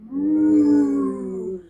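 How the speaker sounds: playful cow moo. Acted. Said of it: "what did the cow say?".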